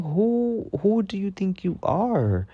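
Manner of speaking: high, drawn-out "who do you think you are" attitude; sassy, put-out telling-off; indignant retort. Retold or imitated. Worker retells snapping back at a whistling customer, indignant and high-pitched.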